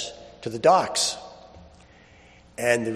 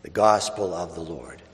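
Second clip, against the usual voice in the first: solemn Gospel reading. Acted. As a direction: read scripture with hushed reverence. Solemn Gospel reading, held style.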